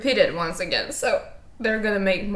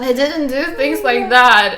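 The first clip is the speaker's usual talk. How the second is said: louder, higher protest broken by giggles; laughing denial; 7 semitones higher, 10 dB louder than usual. Real, not acted. Insisting she never got expelled, laughing too hard. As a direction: try to explain but keep cracking up.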